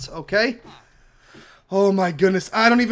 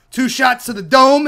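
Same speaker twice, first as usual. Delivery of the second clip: loud, higher gloating call; triumphant shout; 5 semitones higher, 8 dB louder than usual. Real, not acted. Gamer finishes off the witch, gloating loudly. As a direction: gloat over the kill.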